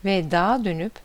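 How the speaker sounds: plain reading voice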